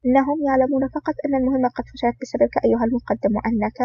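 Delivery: plain reading voice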